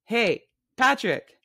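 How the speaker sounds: mock-sweet hail across a busy bar; calling out. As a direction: wave him over with a slow, loud call.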